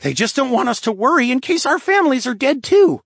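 put-on high, loud, agitated boy; urgent, irritated lynx boy; hissed rebuke. A narrator's reading. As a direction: press the point sharply in a high kid's voice. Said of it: "Pratt hissed".

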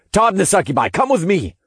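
high, firm order; commanding. A narrator's reading. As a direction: call out a command to the team. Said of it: "I commanded".

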